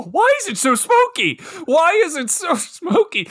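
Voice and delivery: high-pitched comic exasperation; exasperated outburst. Real, not acted. Podcaster ranting about a movie's foggy bar, exasperated squeal. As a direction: complain in disbelief, voice shooting up into laughter.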